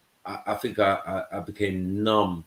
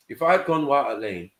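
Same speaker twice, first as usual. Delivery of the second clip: usual speaking voice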